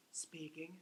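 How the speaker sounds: a put-on voice, very soft and breathy-light; different voice. Shown as a demonstration. Teacher plays a different voice for the class. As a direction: answer the phone in a funny put-on voice. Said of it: "when I play different voices".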